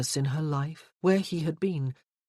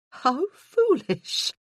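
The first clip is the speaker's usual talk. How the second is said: high, slow laughing exclamation; laughing protest; 10 semitones higher, 6 dB louder, much slower than usual. A narrator's reading. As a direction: laugh it off as nonsense.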